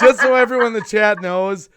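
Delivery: loud, laughing through the words. Real, not acted. Streamer to chat, clearing up a running joke, laughing. Joke about Lou Rock being fake.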